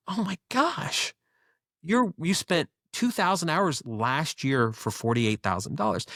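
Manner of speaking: high, slow, drawn-out shock at the number; stunned disbelief. Retold or imitated. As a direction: act floored by how little he made. Reacting to his tiny income.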